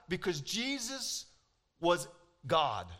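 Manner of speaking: normal talking voice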